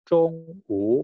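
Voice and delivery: Zong u with Zong high and flat, u dipping; level first tone. Shown as a demonstration. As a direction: hold Zong high and level, then dip u. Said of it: "nada datar".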